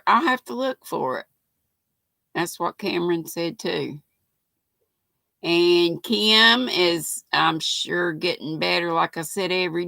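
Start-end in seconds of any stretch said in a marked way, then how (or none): none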